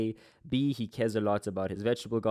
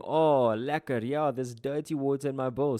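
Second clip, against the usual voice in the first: mock delight, higher pitch with a loud "Oh"; sarcastic; 4 semitones higher, 4 dB louder than usual. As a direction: act out sarcastic approval, starting loud and pitched up. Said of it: "So he's being sarcastic here".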